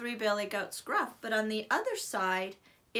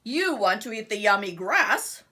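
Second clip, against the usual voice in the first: loud mocking troll; troll roar; 8 dB louder than usual. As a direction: roar it back at the goat. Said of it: "the mean old troll roared".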